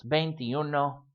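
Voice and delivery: veintiuno run together without gaps; said as one word. Shown as a demonstration. Teacher showing the one-word form, veintiuno run together. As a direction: join veintiuno into a single word. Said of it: "frequently written as one word".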